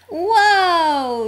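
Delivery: high, drawn-out cry of dismay; dismayed yell. A narrator's reading. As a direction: yell a long, falling whoa. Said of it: "yelled Cleo".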